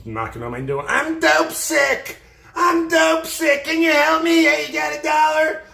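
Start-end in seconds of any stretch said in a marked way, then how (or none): 0.9-2.2 begging wail. Retold or imitated. Repeated begging line. 2.6-3.6 begging wail. Retold or imitated. Repeated begging line. 3.6-5.6 high, loud, slow pleading wail; shrill-free loud desperate whine, high and drawn out; begging junkie. Retold or imitated. Junkie girls begging at his door.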